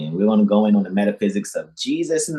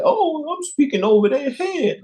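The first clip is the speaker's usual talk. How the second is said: much higher, mocking fussy voice; mocking condescension; 3 dB louder than usual. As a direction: lampoon a condescending speaker. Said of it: "I don't care to speak to you like".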